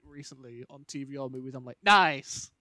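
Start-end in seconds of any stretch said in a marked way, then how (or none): 1.8-2.5 loud, higher cheer of approval; mock delight. Brit hearing a British accent on TV, loud mock delight. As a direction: punch it out like a small victory.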